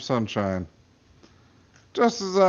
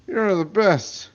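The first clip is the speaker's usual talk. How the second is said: slow gushing thanks; 6 semitones higher, 4 dB louder, slower than usual. Acted. Ellie thrilled with cocaine.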